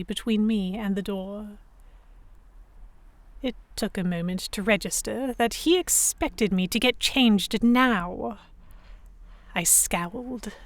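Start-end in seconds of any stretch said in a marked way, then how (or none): none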